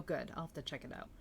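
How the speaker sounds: ordinary talk, nothing marked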